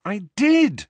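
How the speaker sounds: high, loud protest; indignant teenage outburst. A narrator's reading. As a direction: insist hotly, stung. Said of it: "said Harry indignantly".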